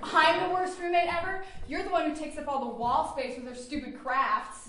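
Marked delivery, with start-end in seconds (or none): 0.0-1.4 higher and louder, indignant echo; shocked. Acted. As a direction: throw the insult back in disbelief.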